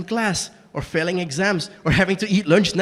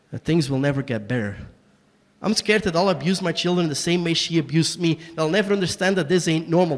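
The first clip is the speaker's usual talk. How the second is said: normal talking voice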